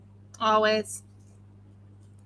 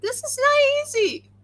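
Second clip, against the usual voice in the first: strained high voice; 13 semitones higher than usual. Real, not acted. Struggling with swirly dice.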